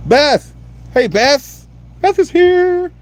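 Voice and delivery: very high, loud squealing greeting; excited squeal. Real, not acted. Host greeting Beth as she joins, squealing with joy. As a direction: squeal her name with delight.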